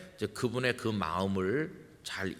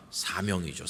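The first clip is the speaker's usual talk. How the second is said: ordinary talk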